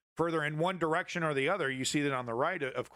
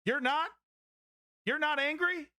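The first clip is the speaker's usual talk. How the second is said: much higher, disbelieving questions; can't believe they're not angry; incredulous; 11 semitones higher than usual. Real, not acted. Streamer to complacent viewers, high incredulous disbelief.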